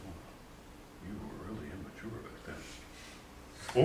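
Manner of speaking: soft, slow amused self-scolding; reading old journal. Retold or imitated. Rereading his teenage journal, a soft slow amused scolding. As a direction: gently tease your younger self. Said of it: "I'm saying".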